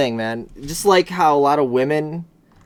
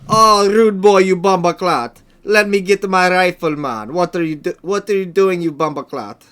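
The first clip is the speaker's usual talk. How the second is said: louder, higher hammed-up Jamaican rude boy; loud mock Jamaican accent; 6 semitones higher, 8 dB louder than usual. Imitating Jamaican rude boys for a friend, in a loud, higher, hammed-up Jamaican accent. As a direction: shout it as a hammy Jamaican gangster. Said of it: "that's better than my Australian accent".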